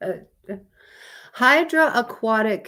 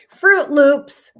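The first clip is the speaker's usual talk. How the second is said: laughing, louder and higher; 6 semitones higher, 10 dB louder than usual. Real, not acted. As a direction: burst out laughing on it. Laughs into the next fact.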